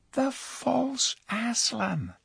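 put-on girl's voice. Jill to Eustace revealing her catch, proud slow girl's voice. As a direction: say it slowly, savouring the surprise.

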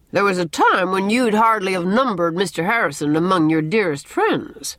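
understated dry reminder; dry remark. A narrator's reading. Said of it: "said Marilla dryly".

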